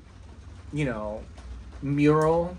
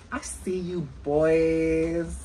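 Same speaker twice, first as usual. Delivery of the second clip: put-on slow, stretched repeat with a long BOYS; drawn-out teasing; much slower than usual. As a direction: drag out "boys" as a playful tease.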